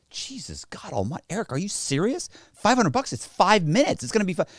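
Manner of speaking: high-pitched pretend indignation; mock outrage. Man haggling with friend over small fee, high mock-outraged protest. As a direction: haggle as if it were a big deal.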